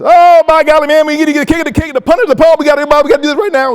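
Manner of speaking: high, loud, fast-talking coach voice. Retold or imitated. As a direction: blast it out like an excited Southern coach. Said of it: "speak loud, often, quickly".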